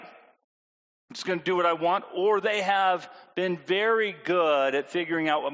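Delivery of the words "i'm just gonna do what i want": put-on teen attitude. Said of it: "super attitude".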